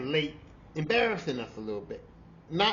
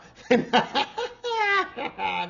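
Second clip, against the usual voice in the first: loud high cackle breaking up; laughing fit; 10 semitones higher, 6 dB louder than usual. Real, not acted. To the audience, a loud high cackle. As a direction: crack up at yourself.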